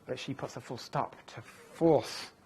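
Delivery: ordinary talk, nothing marked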